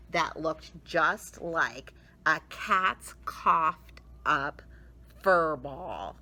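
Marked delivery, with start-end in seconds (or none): none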